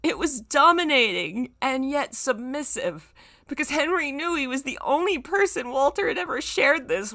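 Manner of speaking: high, giggly reading voice; laughing while reading. Real, not acted. Reading the fic aloud, still giggling. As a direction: push the words out through laughter.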